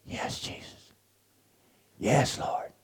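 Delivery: soft whispered reply in the dark; whispered amen. Retold or imitated. Roommate answering the prayer from his bed, whispered.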